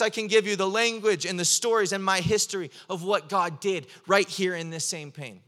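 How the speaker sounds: loud, rising sermon delivery; impassioned preaching. Acted. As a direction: preach it with full fervor, building. Preaching at full force.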